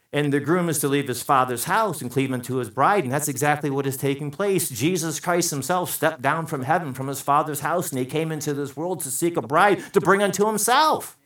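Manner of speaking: ordinary talk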